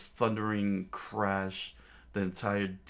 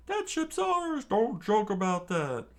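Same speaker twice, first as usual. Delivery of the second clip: very high squeaky shout; angry pirates; 13 semitones higher, 5 dB louder than usual. Acted. Pirates angry about their ship, high shouting. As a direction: squeal it in outrage. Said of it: "also shared their anger".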